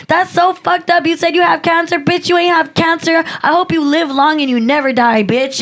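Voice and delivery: high, indignant tirade; heated retold rant; retold cursing-out.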